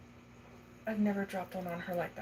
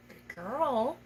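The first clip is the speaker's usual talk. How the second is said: 1 high cooing praise; 5 semitones higher, 5 dB louder than usual. Real, not acted. Praising spider after catch.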